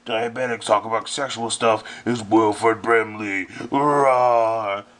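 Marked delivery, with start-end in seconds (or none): none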